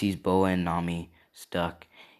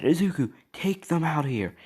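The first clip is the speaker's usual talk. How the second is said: raised, louder voice, mildly acted; higher-pitched acted command; urgent order; 9 semitones higher, 3 dB louder than usual. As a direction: call out an order to a teammate. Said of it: "he said".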